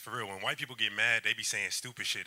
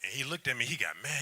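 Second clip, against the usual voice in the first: normal talking voice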